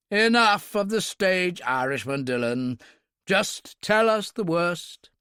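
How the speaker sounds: usual speaking voice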